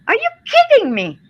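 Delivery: high-pitched outraged disbelief; real anger. Real, not acted. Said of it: "what I say when I'm mad".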